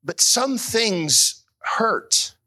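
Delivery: slow aching words for the grieving; slow earnest grief. Real, not acted. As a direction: let the hurt slow every word. Preaching slowly on grief.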